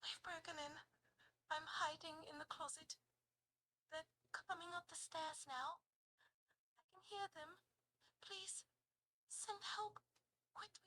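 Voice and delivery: hushed, frightened posh British caller; posh British accent. Acted. Hiding, phoning emergency services, posh British accent, panicking. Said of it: "whispering ... very posh, educated, uh, British accent".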